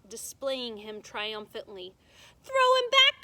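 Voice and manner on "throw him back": high, loud taunting call; loud boyish shout. A narrator's reading. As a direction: call across the deck. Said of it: "called Martin".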